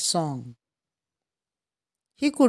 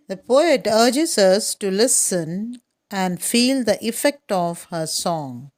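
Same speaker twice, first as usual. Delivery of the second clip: ordinary talk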